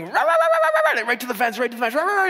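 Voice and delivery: high loud yappy barks; dog barking. Retold or imitated. Preacher imitating neighbour dogs barking at walkers, loud high barks. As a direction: yap furiously like a guard dog. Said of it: "these dogs come run out and".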